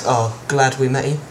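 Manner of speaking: usual speaking voice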